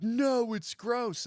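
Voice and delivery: high, loud, disgusted no; loud refusal. Retold or imitated. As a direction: turn it down loudly. Refusing the offered Vegemite.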